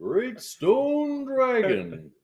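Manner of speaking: slow, grand, high mock-epic voice; epic dragon voice. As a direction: intone it like a legendary beast. Echoing the epic dog name.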